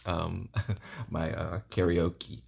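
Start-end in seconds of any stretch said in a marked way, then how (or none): none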